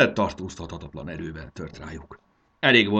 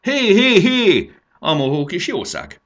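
high, loud excited hollering; calling out; 11 semitones higher, 9 dB louder, slower than usual. A narrator's reading. Marci hollers at the greedy little cow, calling out. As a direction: holler after a runaway animal.

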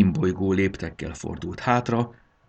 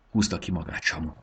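ordinary delivery, nothing marked

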